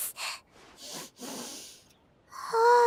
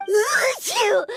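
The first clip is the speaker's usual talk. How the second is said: pained shouted sneeze; miserable sneeze; 2 semitones lower than usual. Acted. Paimon's allergy worsens, a miserable sneeze. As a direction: sneeze helplessly.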